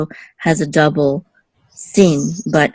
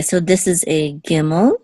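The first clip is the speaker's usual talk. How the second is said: ordinary talk, nothing marked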